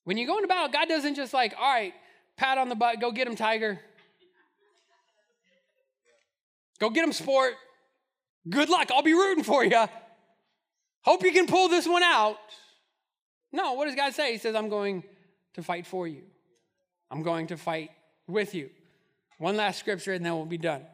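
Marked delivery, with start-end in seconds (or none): 1.6-12.4 mock cheerleader. God voiced as casual cheerleader. 14.6-18.7 tender promise. Retold or imitated. God voiced, tender promise.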